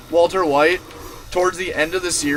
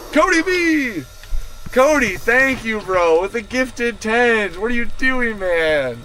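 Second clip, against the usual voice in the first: high, delighted shout-out; real thrilled surprise at a generous gift; excited thanks; 6 semitones higher than usual. Real, not acted. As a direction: hail a donor, pitch jumping way up. Streamer reacting to gifted subs.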